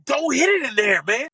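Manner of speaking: high, loud scolding of a golfer; a high, loud told-you-so rant; exasperated yell. Retold or imitated. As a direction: chew him out for ignoring you. Scolding the golfer after bunker shot.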